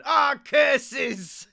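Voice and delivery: loud, high hammed villain cry; cartoon villain curse. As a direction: shake your fist like a foiled villain. Villain foiled.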